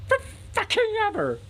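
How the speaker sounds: high, loud exploding shriek; God's furious high-pitched blow-up; enraged. Acted. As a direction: scream the final verdict. Enraged God's final retort before storming off.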